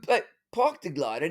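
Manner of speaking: ordinary talk, nothing marked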